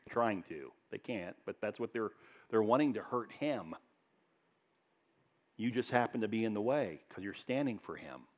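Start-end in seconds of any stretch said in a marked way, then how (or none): none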